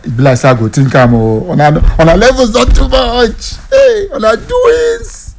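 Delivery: imagined player's reply building to a high excited cry; playing Morgan. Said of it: "Morgan will just say".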